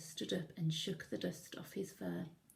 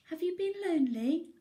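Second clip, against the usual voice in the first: high, louder small bear; a gentle little bear asking; Little Bear asking his old friend, in a high voice; 10 semitones higher, 7 dB louder, faster than usual. Acted. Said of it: "asked Little Bear".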